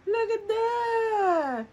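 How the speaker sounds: high, slow, drawn-out cry; excited awe. Real, not acted. Showing the viewers her short rib, slow high delighted cry. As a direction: stretch it out in delight.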